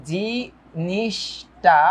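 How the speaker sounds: very slow, each syllable held apart. Shown as a demonstration. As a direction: stretch জিনিসটা out as jii-nish-taa. Breaking a word into syllables. (টেনে টেনে, ভেঙে ভেঙে)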